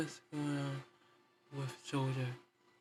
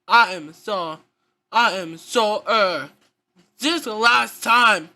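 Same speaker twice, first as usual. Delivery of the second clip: furious yelling, much louder; angry shouting; 12 dB louder than usual. Acted. Child pretending, screams at Carl to get away. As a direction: shout him away in a rage.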